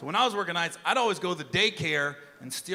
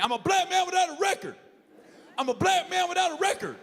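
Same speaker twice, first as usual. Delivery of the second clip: driver yelling; 11 semitones higher than usual. Retold or imitated. Driver yelling at the cop at the window, high indignant protest. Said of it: "He's like".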